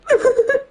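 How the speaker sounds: breathless, squeaky laugh; laughing almost to tears; teary laugh. Real, not acted. Laughing at own situation.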